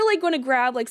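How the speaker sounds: ordinary talk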